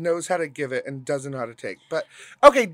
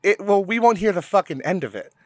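ordinary delivery

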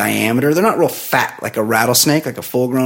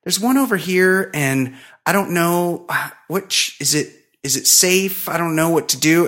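higher, halting neighbor lady voice; hesitant high woman; 6 semitones higher than usual. Retold or imitated. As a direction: dither anxiously in her higher voice. Woman describing the snake.

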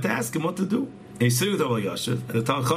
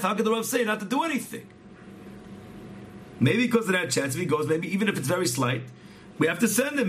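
much higher, urgent, imploring; retold tearful pleading; 8 semitones higher than usual. Wife begging the rabbi to save her husband, acted tearful pleading. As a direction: imitate a distraught plea, pitched high throughout. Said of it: "she's crying to the depths of her heart".

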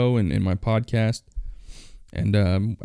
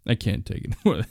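ordinary talk, nothing marked

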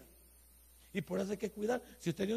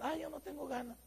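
higher, softer mock whine of a lazy husband; reluctant husband, a mock lazy whine; 5 semitones higher, 6 dB quieter than usual. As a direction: whine it like you can't be bothered.

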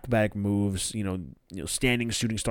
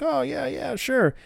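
put-on drunk agreeing; 14 semitones higher than usual. Hangman voiced drunk.

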